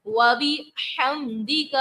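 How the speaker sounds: slow وَبِحَمْدِكَ with a clear throat ح; careful pronunciation. Shown as a demonstration. Teacher modelling correct pronunciation of وَبِحَمْدِكَ. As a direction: say وَبِحَمْدِكَ slowly, the ح from mid-throat. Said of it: "تلفظ کی ادائیگی درست طریقے سے کریں گے".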